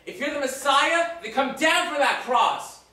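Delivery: high, loud jeering taunt; swaggering, drawn-out mockery yelled at full volume; mocking shout. Pharisees jeering at the crucified Jesus, mocking shout.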